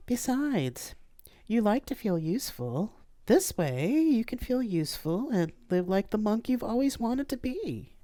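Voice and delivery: playful friend ribbing him; Mandy's voice. Acted.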